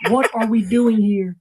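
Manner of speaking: normal talking voice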